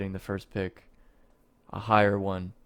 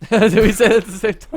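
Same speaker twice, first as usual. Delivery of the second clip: laughing; 12 semitones higher, 12 dB louder, faster than usual. Real, not acted. Laughing at saying the same thing.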